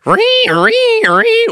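high, loud squealing pedal noise; loud high ree ree of a delay pedal; imitated delay squeal. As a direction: mimic a guitar effect squealing up high. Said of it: "he'd go".

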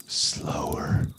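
unvoiced whisper; breathy slow whisper. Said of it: "No, no, no".

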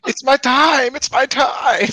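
mock villain glee. Joking about turning cannibal, gleeful mock villain. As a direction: savor it like a hungry villain.

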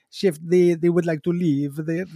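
a lightly put-on, hammed-up French accent. Maitre d' telling Gordon Ramsay guests want to leave, light mock French accent. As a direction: play the little French maitre d' in a mild French accent. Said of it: "he has a very exaggerated French accent".

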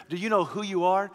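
usual speaking voice

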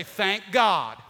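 loud, ringing Thank God; shouted praise. Acted. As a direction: call it out to the congregation. Congregation call and response.

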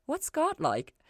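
Ella asking Lucifer about God, curious. Acted. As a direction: blurt the big question. Ella asks boldly.

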